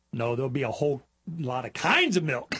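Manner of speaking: ordinary delivery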